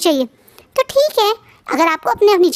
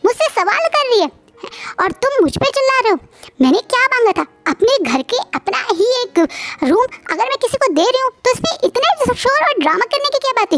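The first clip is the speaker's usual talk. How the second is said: much higher, indignant protest; irritated outburst; 8 semitones higher than usual. A narrator's reading. Wife snapping back at her shouting husband, much higher and indignant. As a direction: flare up, outraged at being yelled at. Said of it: "और चिढ़ते बोलती है".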